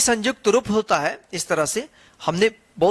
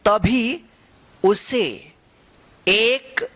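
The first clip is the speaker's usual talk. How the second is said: slow story reading, one word at a time; reading to children; much slower than usual. Acted. Teacher reads the story to children, slow and word by word. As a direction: read it out word by word so kids follow.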